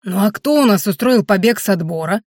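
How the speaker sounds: usual speaking voice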